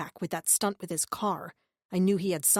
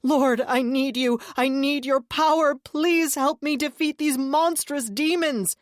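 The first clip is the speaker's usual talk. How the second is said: high, loud, slowing plea; panicked prayer; 7 semitones higher, 6 dB louder, slower than usual. A narrator's reading. Deluded brother praying frantically for help. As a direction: pray in desperate fear.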